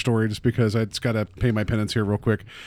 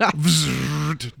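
a short buzzing zap through the lips; electric buzz. Retold or imitated. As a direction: buzz like the live fence. Imitating the electric fence zap.

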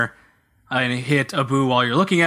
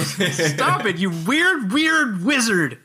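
high, drawn-out hammed-up reproach; mock indignant scolding; 5 semitones higher, much slower than usual. Player tells off the creepy wizard, mock indignant. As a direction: stretch the insult in playful outrage.

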